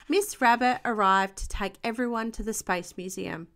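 usual speaking voice

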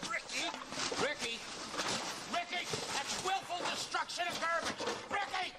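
high, rising repeated yells of the name; exasperated shouting at a runaway; yelling after Ricky. Acted. Calling after Ricky destroying garbage.

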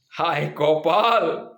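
slow sighing woe; drawn-out comic despair; mock lament. Real, not acted. Narrator laments his fate to listeners, slow mock despair.

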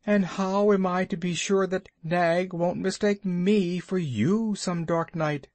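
high, fretful little muskrat voice; timid muskrat. Acted. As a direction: worry aloud like a small frightened creature. Muskrat's fearful plea.

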